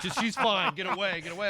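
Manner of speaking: hammed-up protective friend yelling get away; angry drunk friend. Friend shooing him off at the bar, mock angry yell. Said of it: "her friend ... was like".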